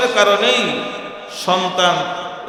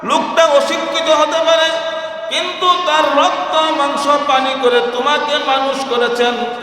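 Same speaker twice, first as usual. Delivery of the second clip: impassioned preaching; 7 semitones higher than usual. Real, not acted. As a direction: plead with the listeners. Preacher raising voice emotionally. (আবেগময় উচ্চস্বরে ওয়াজ)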